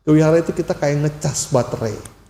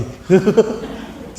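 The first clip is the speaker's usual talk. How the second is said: breathy laugh; 7 semitones higher than usual. Real, not acted. Laughs at own story.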